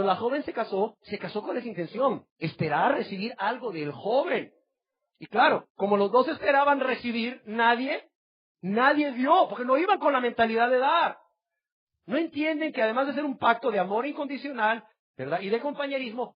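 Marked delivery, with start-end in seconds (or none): 5.2-16.4 rising, louder preacher's cry building to a shout; preaching at full force. Acted. Pastor crying out to the congregation about marriage, impassioned preaching. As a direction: preach it out, climbing to a fervent cry.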